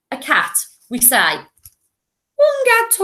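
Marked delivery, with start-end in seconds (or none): 2.4-3.0 very high, squeaky silly voice; silly cat voice. Shown as a demonstration. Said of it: "the silly voices that we do".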